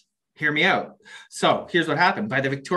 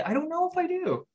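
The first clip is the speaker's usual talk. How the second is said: high squeak; 13 semitones higher, 6 dB quieter than usual. Real, not acted. Repeats line, first much higher.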